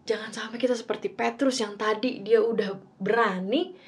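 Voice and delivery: ordinary talk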